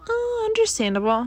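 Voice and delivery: high quick sing-song understandable; lightly sarcastic; airy mock sympathy; mock understanding. Real, not acted.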